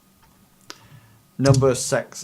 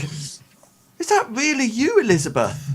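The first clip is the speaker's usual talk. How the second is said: high-voiced old man in wonder; old man's disbelief; 10 semitones higher than usual. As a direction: play a disbelieving old father, pitch leaping up. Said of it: "he's sort of like".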